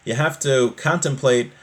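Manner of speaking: ordinary talk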